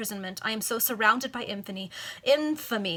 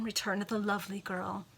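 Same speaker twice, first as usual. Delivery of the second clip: plain reading voice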